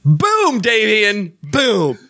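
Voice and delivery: high, loud gleeful boom; boom sound. Shown as a demonstration. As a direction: shout boom on request. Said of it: "can you make the boom sound?".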